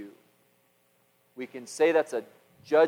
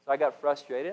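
normal talking voice

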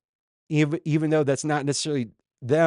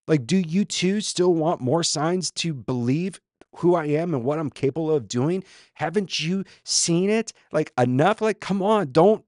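higher, insistent, pressing questions; exasperated plea; 5 semitones higher, 3 dB louder than usual. Acted. Jesus to disciples who want more signs, exasperated. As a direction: push each question up and out, urging them.

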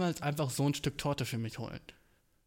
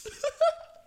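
high, loud burst of laughter; sudden high-pitched laugh; 6 dB louder than usual. Real, not acted. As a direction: crack up at your own joke. Laughs at own cake rant.

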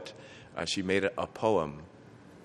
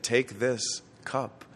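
slow, raised and reverent words of Jesus; the Lord offering the cup, slow and solemn; 4 semitones higher, much slower than usual. Acted. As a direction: speak it slowly as Christ offering the cup. Voicing Jesus at Last Supper.

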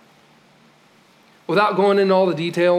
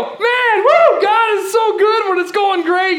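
hammed-up whooping praise, much higher and louder; mock cheering praise; 6 dB louder, slower than usual. Preacher mocking churchgoers' easy praise, with hammed-up whooping excitement. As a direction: shout it like a giddy fan at a rally.

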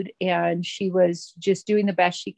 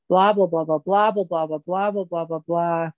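mock rambling. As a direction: rattle off blahs without a break, trailing away. Imitating own nonstop talking to sponsor.

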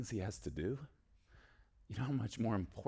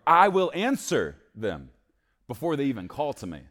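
louder, higher proclamation of God's words; proclaiming scripture; 7 semitones higher, 12 dB louder than usual. Acted. Preacher reading Isaiah to the congregation, proclaiming. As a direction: declare the verse with force. Reads God's promise aloud.

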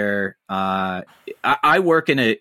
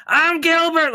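much higher, screechy fake comic voice; over-the-top Gilbert Gottfried; slower than usual. Contrasting Gilbert's stage act with his real voice, over the top. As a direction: blast it out like his cartoonish stage shtick. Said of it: "like the over the top".

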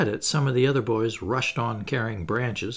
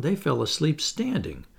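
plain reading voice

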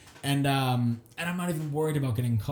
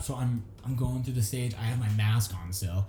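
ordinary talk, nothing marked

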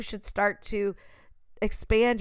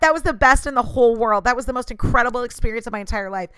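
loud, higher, fast superlative gushing; hammed-up loud gushing; over-the-top gushing; 4 semitones higher, 7 dB louder, faster than usual. Shown as a demonstration. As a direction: blow it up like the best thing ever. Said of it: "But it's not".

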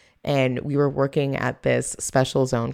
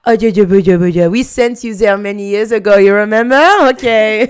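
mocking French colleagues; 9 semitones higher, 9 dB louder than usual. French coworkers teasing Canadians, mocking voice. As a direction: coo down at cute little Canadians. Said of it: "they'd be like".

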